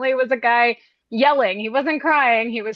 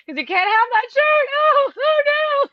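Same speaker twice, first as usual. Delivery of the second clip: mock whine. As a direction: whimper it like a mock crybaby. Mocking whiny crier.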